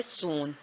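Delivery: "सून" with a lengthened oo; long vowel. Shown as a demonstration. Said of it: "double ओ की जो length है वो ज़्यादा है".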